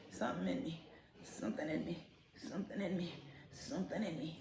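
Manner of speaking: hushed repetition. Real, not acted. Hushed murmur, overcome by a tiny teacup dog. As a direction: murmur it like you're melting.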